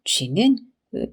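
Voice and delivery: ordinary delivery, nothing marked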